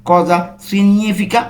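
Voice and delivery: "significa" slowly as "si-nyi-fica", g not sounded; silent g. Shown as a demonstration. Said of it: "জি এর উচ্চারণ কিন্তু উজ্জ থাকছে". ("significa"-তে জি অনুচ্চারিত, ধীর উচ্চারণ)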